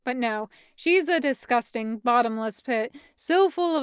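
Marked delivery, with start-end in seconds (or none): none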